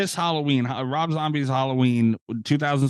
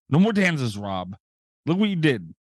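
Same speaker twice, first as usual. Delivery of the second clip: ordinary delivery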